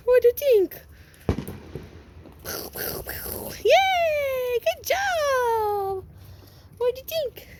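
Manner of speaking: high sing-song pet voice. Real, not acted. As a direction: coax them into having fun. Asking puppies playfully.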